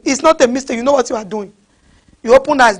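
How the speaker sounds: ordinary talk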